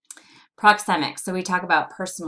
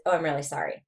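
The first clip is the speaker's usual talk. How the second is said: quick, run-together apology; faster rate; 3 semitones lower, much faster than usual. Shown as a demonstration. Lecturer demonstrates rate to students, hurried delivery. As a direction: rush through "Oh, I'm really sorry". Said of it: "The rate's gonna make a difference".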